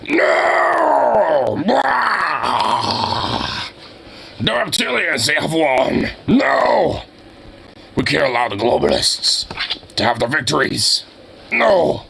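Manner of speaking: hammed-up ranting yell with cries of no; imitating a frantic shouted rant; Alex Jones screaming. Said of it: "Alex Jones is just screaming".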